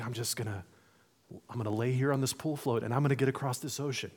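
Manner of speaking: soft, laid-back mumble to yourself; quiet, low-effort acted quote; laid-back float lounger. Acts out lazy float passenger.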